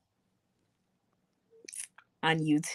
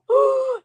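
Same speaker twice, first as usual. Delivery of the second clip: sharp, high gasp of surprise; startled gasp; 7 dB louder than usual. Real, not acted. Streamer realising the heat is on, startled gasp. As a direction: suck in a loud breath of surprise.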